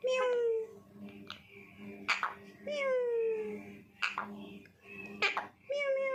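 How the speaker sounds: high, soft mock meows for the parrot; cat meow imitation. Shown as a demonstration. Owner shows parrot how the cat talks, soft high meowing. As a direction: meow like a cat to teach the bird. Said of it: "वो कैसे बोलता है?".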